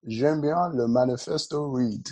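put-on French in a Nigerian accent; playful Nigerian-accented French. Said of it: "why are you speaking in a Nigerian accent".

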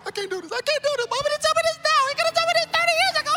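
very high, fast comic wailing; hammed-up squeaky crying meltdown; mock tearful panic. Preacher curled up in hotel room, mock sobbing panic. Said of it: "tears coming down my face".

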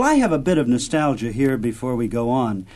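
ordinary delivery

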